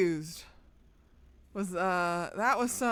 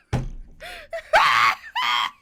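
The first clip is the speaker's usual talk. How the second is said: high shrieking burst of laughter; laughing fit at the cat; loud laugh; 13 semitones higher, 9 dB louder than usual. Real, not acted. Streamer cracks up.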